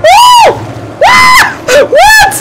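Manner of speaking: real scared screams on a thrill ride; screaming. Real, not acted. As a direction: shriek as the ride drops.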